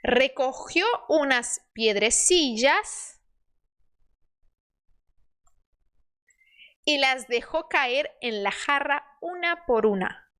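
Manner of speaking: slow, measured Spanish story reading for learners; slow model reading. Shown as a demonstration. Teacher reading the crow fable to learners, slow model reading. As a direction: read each phrase carefully, spacing the words.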